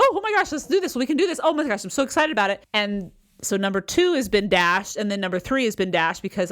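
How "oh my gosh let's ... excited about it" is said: gushing, high-pitched rush of enthusiasm; fast, high excitement. Acted. Coach imitating their own excited chatter, high and rapid. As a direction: race through it, pitch way up. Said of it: "I'm talking very quickly and I get excited".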